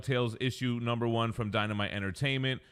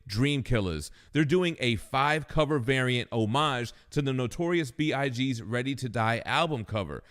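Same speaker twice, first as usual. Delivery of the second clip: loud upbeat promo read; punchy announcer pitch; 2 semitones higher, 5 dB louder than usual. Acted. Reads a comic ad spot.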